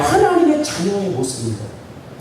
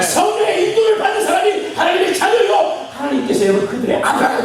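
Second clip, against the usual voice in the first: full-force preaching; 10 semitones higher than usual. Acted. Preacher blesses the congregation at full force. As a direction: preach it out to the whole room.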